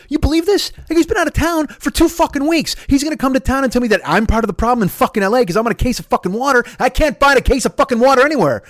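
high, loud, incredulous rant; retold angry yelling. Ranting to a woman with a cart about his brother, yelling. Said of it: "you should probably stop yelling".

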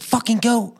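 high, mock angry bark; angry boss. Imagined Disney boss barking at staff, mock angry. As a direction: snap at the staff in mock fury. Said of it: "How they treat the characters".

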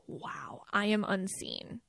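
whispered, a playful imitation of feeling hurt; mock wounded whisper. Hurt partner complaining they go unnoticed, mock whisper. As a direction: breathe it out like a partner who feels ignored. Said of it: "that empath or a partner can be like".

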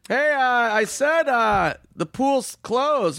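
high, slow dopey guard; mild-mannered guard repeating himself; security guard voice. Retold or imitated. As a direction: drag it out like a weary guard. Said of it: "He goes".

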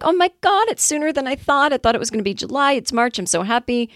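high-pitched "oh my God" delight, easing down; excited reaction. Retold or imitated. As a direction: replay being thrilled by good news. Acting out her excited reaction to email.

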